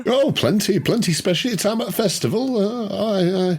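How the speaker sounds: high, fussy little shopkeeper voice; chatty shopkeeper. Acted. Shopkeeper greeting a stranger across the counter, chatty and high. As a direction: natter away like a nervous village shopkeeper. Shopkeeper Brian rambling about trade.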